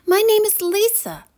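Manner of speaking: put-on a kind girl offering help; voiced young girl. A narrator's reading.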